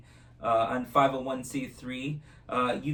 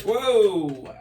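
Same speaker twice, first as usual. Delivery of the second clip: surprised whoa; 8 semitones higher, 9 dB louder than usual. Real, not acted. Trick surprises him.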